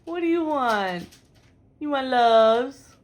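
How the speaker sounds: slow, loud cooing to a cat; pet talk. Real, not acted.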